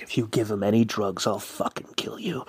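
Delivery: low, hushed menace, imitated; muttered threat. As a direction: murmur a threat close into someone's ear. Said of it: "in my ear, he's like".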